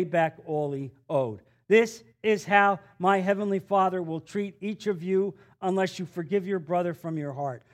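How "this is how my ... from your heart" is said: ordinary talk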